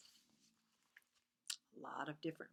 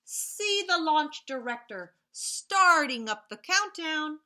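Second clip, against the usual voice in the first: excited countdown; 8 semitones higher, 14 dB louder, slower than usual. Acted. Reading to kids, rocket countdown building to a loud launch. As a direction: build up loud to a shouted blast off.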